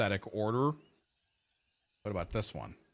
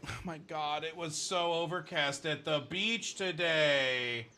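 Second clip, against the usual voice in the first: mocking valley girl; 5 semitones higher than usual. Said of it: "She's like".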